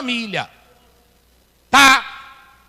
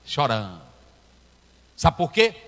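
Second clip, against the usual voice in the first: normal talking voice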